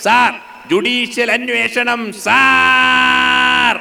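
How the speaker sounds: straining to be heard over the House; V S shouting in assembly. Retold or imitated. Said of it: "നമ്മൾ അനുകരിക്കുന്ന രീതിയിൽ അദ്ദേഹം ഒച്ചയെടുക്കും". (നിയമസഭയിൽ ഉയർന്ന സ്വരത്തിൽ വി എസ്സിന്റെ വിളി)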